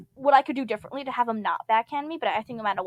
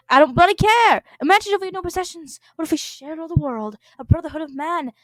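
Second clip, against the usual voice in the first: angry John; 5 semitones higher, 5 dB louder than usual. Acted. John voiced in a rage.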